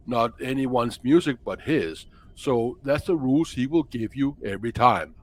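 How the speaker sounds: sustained put-on Danish accent. Co-host as musician defending his music from downloaders, Danish accent. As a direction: lecture earnestly in Danish-accented English. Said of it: "[name] kinda speaks more like this".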